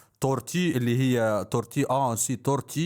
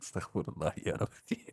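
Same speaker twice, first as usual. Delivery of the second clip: hushed whisper to himself; whispered aside; 6 semitones lower, 9 dB quieter than usual. Real, not acted. Streamer whispering a prayer to himself after the excitement. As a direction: drop to a breathy whisper, calming down.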